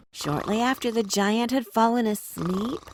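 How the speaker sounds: ordinary delivery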